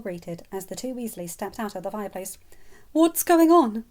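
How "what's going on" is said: worried, high and loud; anxious. Acted. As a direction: ask it in sudden alarm. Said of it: "Molly asked instantly".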